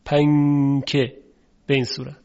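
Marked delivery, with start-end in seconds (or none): 0.1-1.2 پنکه drawn out very slowly, with the نون nasalized and hidden; slowed-down پنکه with a hidden نون. Shown as a demonstration. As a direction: stretch پنکه slowly and let the نون hum.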